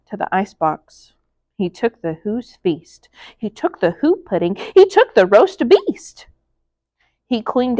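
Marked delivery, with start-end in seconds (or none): none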